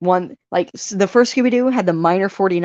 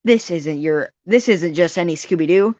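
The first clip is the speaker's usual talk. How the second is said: normal talking voice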